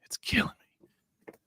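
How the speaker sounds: whispered groan of dismay; whispering. Real, not acted. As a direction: breathe it out, despairing. Groaning aside about November.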